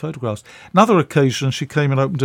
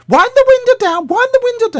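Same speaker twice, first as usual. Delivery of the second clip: much higher, louder put-on voice of an elderly actress giving orders; Patricia Hayes; 8 dB louder than usual. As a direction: pitch it up high and insist. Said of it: "Anyway, she said".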